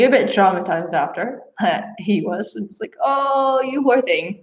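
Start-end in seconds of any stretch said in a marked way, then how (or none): 3.0-4.4 high, drawn-out sympathetic "oh", ending in a laugh; retold cooing over a poor animal; pitying coo. Pitying the traumatized horse, retold.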